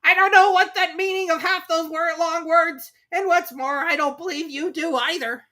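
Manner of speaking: cheeky Eaglet. Acted. Eaglet mocking the Dodo, high and loud. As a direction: demand it cheekily. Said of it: "said the Eaglet".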